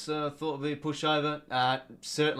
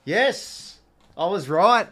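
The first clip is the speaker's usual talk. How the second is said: higher and louder burst of triumph; triumphant; 6 semitones higher, 9 dB louder, much slower than usual. Real, not acted. Fan celebrating that the review proved him right, loud and triumphant. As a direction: shout it like you called it. Score review confirms his call.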